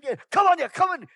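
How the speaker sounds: high, loud impatient yell at a lost stranger; retold angry shout. Driver yells at lost traveler at airport gate, angry shout. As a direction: bark it out like an irritated driver. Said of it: "He's shouting at me".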